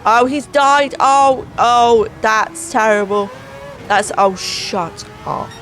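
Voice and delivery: normal talking voice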